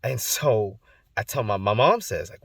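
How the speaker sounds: ordinary delivery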